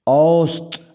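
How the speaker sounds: اوسط ending on t: aust, no "-an"; correct pronunciation. Shown as a demonstration. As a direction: finish اوسط firmly on the t. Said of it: "صحیح تلفظ ہوگا". (اوسط کا صحیح تلفظ، تنوین کے بغیر)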